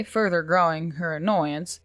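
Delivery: ordinary delivery, nothing marked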